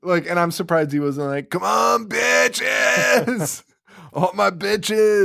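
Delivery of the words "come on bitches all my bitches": high, stretched showman's yell; hammed-up, drawn-out high holler; mock crowd-hyping shout. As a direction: whoop it out like a villain working the crowd. Imagined Freddy hyping up a crowd.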